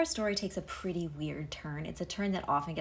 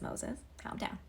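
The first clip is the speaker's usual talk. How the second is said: hushed God; 5 semitones higher, 8 dB quieter than usual. Retold or imitated. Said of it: "And God says".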